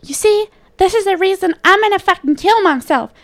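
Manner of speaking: high mock mother voice; mocking mom. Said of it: "she's all like".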